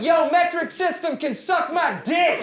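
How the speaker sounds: loud, high, drawn-out mock heckle; heckler's yell. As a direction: holler it out as a rowdy heckler. Acts out heckler shouting from back.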